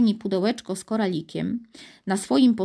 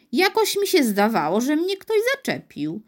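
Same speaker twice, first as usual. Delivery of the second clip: uneasy guard, pitch climbing at the end; mildly rattled suspicion; flustered; 7 semitones higher than usual. A narrator's reading. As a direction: puzzle nervously over being touched. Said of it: "zdenerwował się wartownik".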